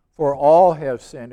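verse quoted with a loud, weighty "all"; stress on "all". Shown as a demonstration. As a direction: hit "all" hard in the verse. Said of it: "I got an emphasis on the word all".

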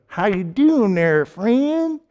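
slow, friendly Southern drawl with a high lift on friend; a folksy Southern greeting, stretched out slowly; easygoing Southern hello; Southern accent. Retold or imitated. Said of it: "But in the South, you get the".

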